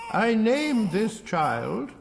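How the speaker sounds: high, slow solemn archbishop voice; drawn-out ceremonial intoning; pompous archbishop. Acted. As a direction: intone it like a grand churchman.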